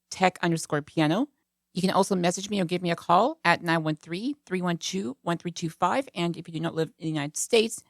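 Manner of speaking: ordinary delivery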